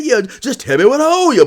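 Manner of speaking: loud, high voice, pushing to pay up; a high, loud, insistent buyer; loud put-on voice.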